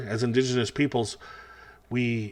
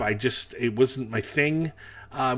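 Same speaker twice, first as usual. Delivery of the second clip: usual speaking voice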